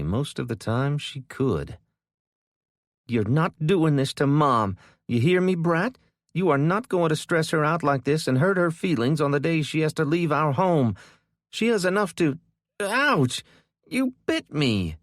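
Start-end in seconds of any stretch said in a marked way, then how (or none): 3.1-15.0 high, loud furious scolding; angry scolding. A narrator's reading. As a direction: sister protecting mom. Kendra scolds struggling sister.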